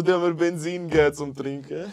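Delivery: high, loud, fast mock panic; acted alarm. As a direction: cry out in hammed-up outrage at being poisoned. Retells his alarm after the drink. (gspielti Panik, luut und höch)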